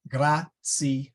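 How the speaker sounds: "Gratia" broken into gra, tsi, a; syllable by syllable. Shown as a demonstration. Teacher giving another three-vowel example, syllable by syllable. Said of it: "you got three syllables".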